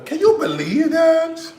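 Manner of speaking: high, slow, put-on squeal of disbelief; mock disbelief. Cook to viewers about the fallen skillet, high mock disbelief. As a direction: stretch it out high as if you can't believe it.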